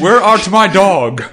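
slow, theatrical olde English; Shakespearean English. Pretend. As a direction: declaim it like a Shakespeare actor. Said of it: "starring Shakespearean English".

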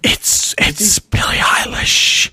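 slow whispered celebrity impression; [name] whisper. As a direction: drag it out in a whisper. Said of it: "what is this voice?".

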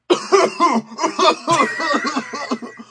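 long, high-pitched hacking cough; coughing fit. Pretend. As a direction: break into a drawn-out coughing fit. Edit out the coughing.

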